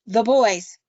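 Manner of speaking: put-on loud, emphatic "The boys"; insistent repeat. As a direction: hit "boys" hard, as if it were obvious. Husband insists, exasperated.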